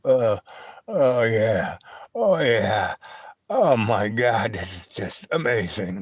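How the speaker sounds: lightly voiced dog savouring the hot dog, drawn out slowly; a hint of a blissed-out dog moaning with pleasure. Man voicing the dog's slow blissful moan over a hot dog.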